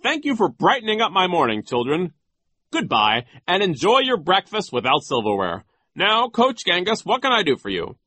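high, loud character voice; officious Nero. A narrator's reading. Nero sends the children off, high officious voice. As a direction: dismiss them in a high, emphatic voice.

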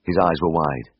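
ordinary delivery

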